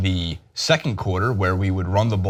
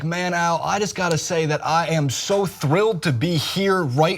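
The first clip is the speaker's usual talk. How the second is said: Chris Collinsworth impression; 6 semitones higher than usual. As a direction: gush like the happiest broadcaster alive. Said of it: "I'm not doing his accent, just his actual, like, verbiage".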